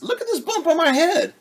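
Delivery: high, loud indignant hen voice; insist, pointing at the bump; insistent hen. Acted. Henny insists.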